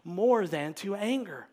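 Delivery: reading Goodwin. Acted. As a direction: proclaim the old text with conviction. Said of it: "He says".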